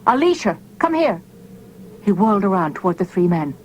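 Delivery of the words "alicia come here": high calling scream. A narrator's reading. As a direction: call out to her, pitched way up. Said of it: "He suddenly turned and screamed".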